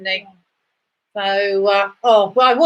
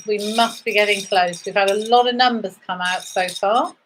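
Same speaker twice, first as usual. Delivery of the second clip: usual speaking voice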